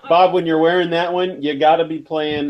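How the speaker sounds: high laugh spilling into words. Real, not acted. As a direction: laugh while talking. Laughs at the mask.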